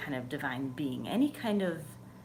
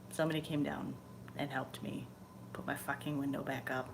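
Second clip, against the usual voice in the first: normal talking voice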